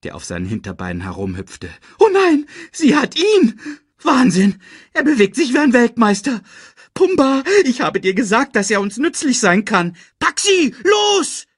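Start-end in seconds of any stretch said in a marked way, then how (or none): 2.0-11.4 high, loud frantic commentary; screeching. A narrator's reading. Timon hops about cheering Simba on, screeching. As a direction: screech like a fan cheering on a fighter. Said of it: "kreischte Timon".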